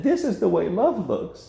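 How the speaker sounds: high, mocking version of the line; mocking the world.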